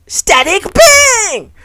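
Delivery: high, drawn-out shouted attack name; anime attack call. Acted. Voicing the electric girl, he calls her attack slowly and loudly.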